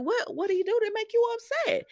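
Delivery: high-pitched imitated questioning; concerned surprise. Retold Steffi questioning upset child.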